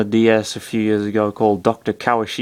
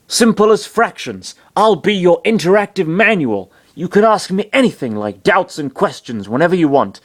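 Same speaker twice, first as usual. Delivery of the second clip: high, eager doctor voice; excited; 6 semitones higher than usual. A narrator's reading. Doctor offers Chris to be his manual, excited. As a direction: offer help with lifted, lively pitch.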